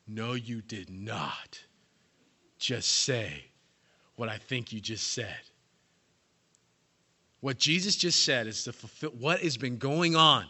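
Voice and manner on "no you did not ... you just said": slow, low, hushed disbelief; stunned disbelief. Acted. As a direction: drag it out in shock. Disciples shocked at Jesus' words.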